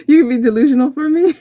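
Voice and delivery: high, loud, fast, laughing through it; giddy disbelief, laughing it out; laughing excitedly. Real, not acted. Reader tells the cards to be delusional for her, laughing.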